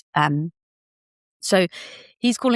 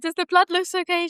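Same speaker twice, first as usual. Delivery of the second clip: high worried question; 12 semitones higher than usual. Retold or imitated. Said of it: "I'm like".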